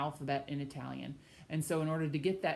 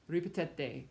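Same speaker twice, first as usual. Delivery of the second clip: ordinary delivery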